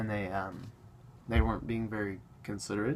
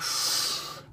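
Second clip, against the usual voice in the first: long breathy inhale and exhale; noisy smoker's puff; mimed weed toke. Acted. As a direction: imitate drawing on a joint. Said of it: "smoking weed or something like".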